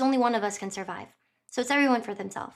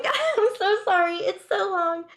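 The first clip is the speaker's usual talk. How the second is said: laughing; 8 semitones higher, 3 dB louder, slower than usual. Real, not acted. Laughing apology to listener.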